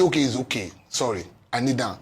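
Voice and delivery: soft, low, pleading to calm someone; soothing plea. Retold or imitated. Calming an angry partner, soft and pleading. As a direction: ease them down gently, begging. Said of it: "so I try to say".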